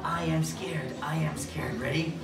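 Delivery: low, soft suspenseful model; hushed, pretend dread; hushed scared. Shown as a demonstration. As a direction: keep it small and low before the scare. Hushed set-up before werewolf comes.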